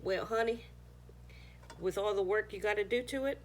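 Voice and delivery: ordinary talk, nothing marked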